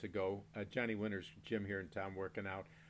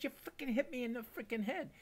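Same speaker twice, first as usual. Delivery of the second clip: high, pitched-up indignant complaint; retold indignant complaint; 13 semitones higher than usual. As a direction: sound outraged and high about the hit. Complaining about being hit.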